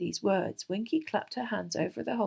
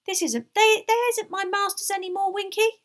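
high, loud, defiant elf squeak; squeaky house-elf standing up for himself; 13 semitones higher, 9 dB louder than usual. A narrator's reading. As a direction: protest defiantly as a tiny elf. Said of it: "said Dobby defiantly".

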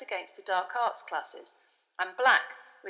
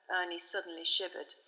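plain reading voice

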